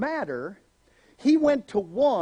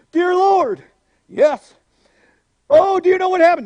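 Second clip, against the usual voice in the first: loud, high frantic calls; mock urgent prayer; 8 semitones higher, 9 dB louder than usual. Believer praying to Jesus in a panic, loud. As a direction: pray in a panic.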